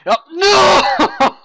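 sudden high yelp of dismay; cry out. Real, not acted. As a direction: shout out in shock. Crash in game, cry out.

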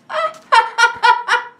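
high burst of laughter; high, rapid cackle; gleeful laugh. Real, not acted. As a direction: laugh helplessly in delight. Laughing again at the loss.

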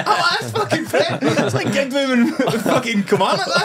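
laughing through the words, slow and high; can barely get it out for laughing; laughing hard. Real, not acted. Telling his mates about Fred, laughing through it.

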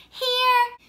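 loud high shout of here; eager kid yelling present. A narrator's reading. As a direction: call out Here at full voice. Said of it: "Junior yelled".

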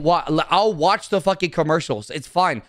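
ordinary delivery

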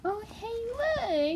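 normal talking voice

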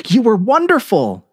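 high thrilled praise; admiring. A narrator's reading. Girl praising the narrator, thrilled. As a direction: gush with triumph. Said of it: "she said".

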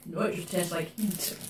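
chewing spicy candy; mouth full while talking. Real, not acted. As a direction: hand covering mouth. Seen 0: Left speaker covers mouth with hand, chewing gummy, until about 4s.

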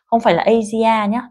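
ordinary talk

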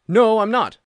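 loud high protest in a gruff figure voice; indignant denial. A narrator's reading. Accused old soldier denying guilt to Holmes, loud protest. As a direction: fling the denial back hard.

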